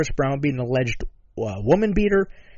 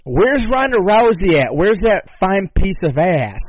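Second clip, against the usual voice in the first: brash callout; 5 semitones higher, 5 dB louder, slower than usual. Retold or imitated. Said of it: "he continued it up with".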